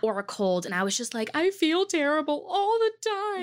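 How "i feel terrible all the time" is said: high-pitched complaint about being sick; exaggerated sickly lament; mock whine. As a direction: wail it lightly, well above normal pitch. Retelling being constantly sick.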